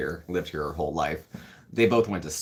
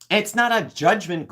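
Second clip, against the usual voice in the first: defensive; 13 semitones higher, 6 dB louder than usual. Real, not acted. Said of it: "defending herself to a viewer".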